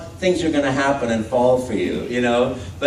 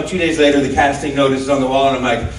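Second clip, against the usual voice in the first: ordinary talk, nothing marked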